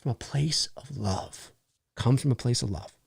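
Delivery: soft, low, lingering delivery; mock tenderness. Host excusing his strictness, softly mock-sincere. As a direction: let it settle down gently.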